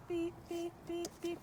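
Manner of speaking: high, quiet beeping cue to the dog. Shown as a demonstration. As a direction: chirp the beeps softly and high, like a signal. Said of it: "when I beep, she backs up".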